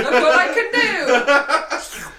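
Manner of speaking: put-on loud, slow, laughing drunk boast; drunk show-off. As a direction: brag like a tipsy show-off. Drunk friend showing off trick.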